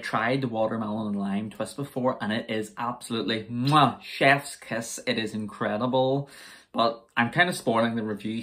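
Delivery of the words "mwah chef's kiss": chef's kiss. Real, not acted. Praises the drink's taste.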